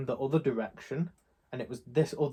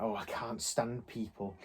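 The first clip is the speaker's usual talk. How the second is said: muttering; 3 semitones lower, 9 dB quieter than usual. Retold or imitated. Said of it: "muttering under your breath".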